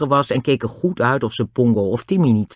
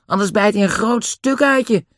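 put-on high, louder boy's voice; unruffled Dick; 10 semitones higher, 4 dB louder than usual. A narrator's reading. Dick advises Den while Pongo attacks, high and cheeky. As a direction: call it over coolly, pitched high. Dick's voice in the story.

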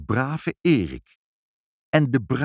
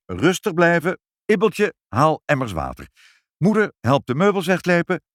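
urgent commands, raised in pitch; calling out orders; 6 semitones higher, 4 dB louder than usual. A narrator's reading. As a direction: take charge in an emergency. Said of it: "riep vader". (dringend bevelen roepend)

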